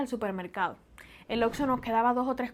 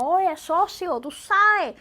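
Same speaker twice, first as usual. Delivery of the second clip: raised, higher-pitched street greeting; loud Cuban talk; 5 semitones higher, 6 dB louder, much slower than usual. Shown as a demonstration. Cuban showing how her people greet a buddy, loud. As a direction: call out to a buddy, loud and high. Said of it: "hablamos alto, gesticulamos muchísimo".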